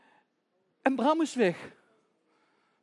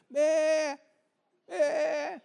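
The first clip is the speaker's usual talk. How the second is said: high, loud imitated bleat; lamb's bleat; 9 semitones higher, 8 dB louder than usual. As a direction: bleat back like a lamb. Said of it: "En dan hoort ze".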